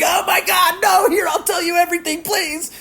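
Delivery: man watching his grape soda poured out, begging in a high shout; mock desperate begging. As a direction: shriek and beg as if everything were lost.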